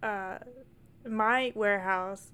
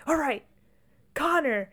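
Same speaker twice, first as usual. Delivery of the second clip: high, fast, hammed-up rallying call; rally everyone like a coach; imitating take-charge husband; 8 semitones higher than usual. Husband orders for hospital trip.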